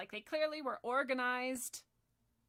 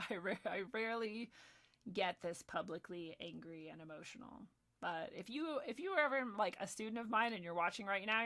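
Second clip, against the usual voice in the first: normal talking voice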